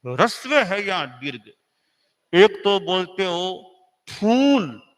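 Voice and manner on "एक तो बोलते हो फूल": फूल with a long drawn-out oo; long vowel फूल. Shown as a demonstration. Teacher demonstrating long oo in फूल. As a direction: stretch the oo in फूल slowly. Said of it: "अब English में एक तो फुल, एक फूल".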